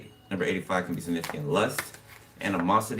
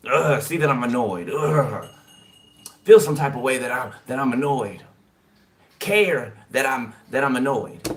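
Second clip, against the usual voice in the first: exasperated mock grumble, pushed louder; annoyed; 3 semitones higher, 5 dB louder than usual. Acting out someone wishing their annoyance gets noticed, annoyed. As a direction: play someone loudly demanding attention for their annoyance. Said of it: "Annoyed, wishing that you would notice it".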